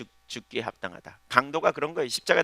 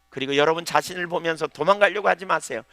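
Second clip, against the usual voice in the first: ordinary talk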